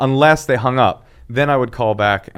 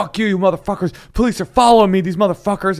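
high, agitated angry rant; irate caller; 9 semitones higher than usual. Acted. Interpreter replaying irate caller to police, angry rant. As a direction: rant at the police in a raised pitch. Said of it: "irate, you know, the guy".

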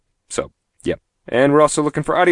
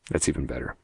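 usual speaking voice

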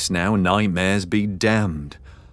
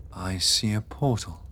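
soft; 6 dB quieter than usual. Acted. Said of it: "Beatrix spoke softly".

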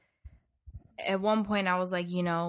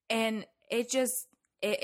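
usual speaking voice

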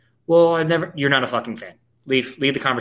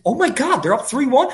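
surprised; 11 semitones higher than usual. Real, not acted. Host hearing his team leads, surprised and high. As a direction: pitch leaps up, excited disbelief.

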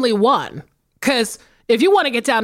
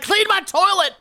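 higher, put-on bossy voice on "Clean my toilet"; mock bossy demand; 8 semitones higher than usual. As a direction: play the fed-up host ordering "Clean my toilet". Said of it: "I'm like".

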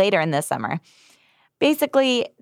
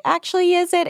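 skeptical newspaper; 12 semitones higher than usual. Retold or imitated. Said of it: "the New York Times is like".

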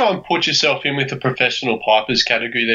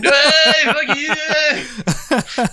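excited holler; 13 semitones higher, much slower than usual. Acted. Driver cheering a friend passing on the road, excited holler.

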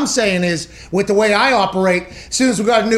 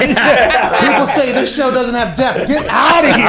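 laughing mock boast; 4 semitones higher, 4 dB louder, slower than usual. Real, not acted.

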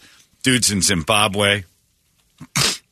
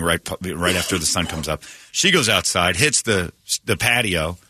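usual speaking voice

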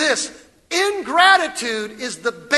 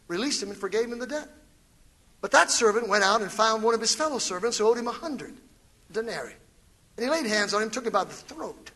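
softer, quick Bible reading voice; scripture reading; 5 semitones lower, 6 dB quieter, faster than usual. Acted. Pastor reading Matthew chapter eighteen to the congregation, soft and quick. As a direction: read the parable quietly and briskly.

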